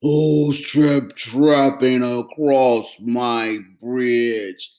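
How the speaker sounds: slow, deep, loud troll roar; troll growling at the goat. Acted. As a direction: menace from under the bridge. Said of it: "A terrible roar rose up".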